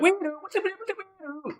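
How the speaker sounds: high mock siren wail, fading off; siren noise. Imitating the cart guy's lightsaber siren, high and mocking. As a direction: make a silly toy-siren sound. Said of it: "they literally says it like that".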